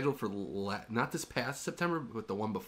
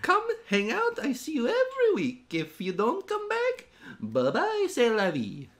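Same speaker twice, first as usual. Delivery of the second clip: very high, loud put-on voice; mock-aggressive send-off; 11 semitones higher, 4 dB louder, slower than usual. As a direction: wave them off in a squeaky pretend huff. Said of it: "Why, why so aggressive?".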